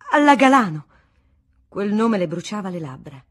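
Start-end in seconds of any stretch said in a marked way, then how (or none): 0.1-0.9 sudden loud burst on the name; bitter outburst. A narrator's reading. Wife hurling the rival's name at her husband, loud and bitter.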